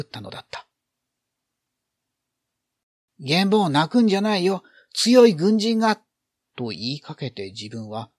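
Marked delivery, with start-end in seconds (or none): 3.2-6.0 高く大きな声で泣く子を励ます; 泣くなと力を込めて言い聞かせる; 励ます子供. A narrator's reading. 泣く子を励ます台詞. (高く張った励ましの声)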